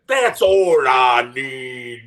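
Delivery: slow, loud mock Scottish accent; loud, drawn-out fake Scottish accent; terrible Scottish accent. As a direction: ham up a bad Scottish brogue. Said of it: "And the, the accent".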